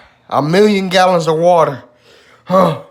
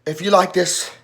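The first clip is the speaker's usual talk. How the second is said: struggling to talk; 6 semitones lower, 9 dB quieter than usual. Real, not acted. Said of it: "I can't even talk".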